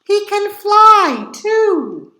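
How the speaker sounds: excited boast. A narrator's reading. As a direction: show off the big secret. Boasting to police chief.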